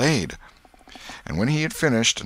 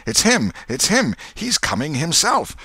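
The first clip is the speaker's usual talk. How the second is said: high, excited cries, getting higher; crowd shouting; 10 semitones higher, 5 dB louder than usual. A narrator's reading. Voices in the crowd spotting the suspect, excited shouting. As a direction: call out the sighting in a raised, urgent voice. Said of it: "voices shouted".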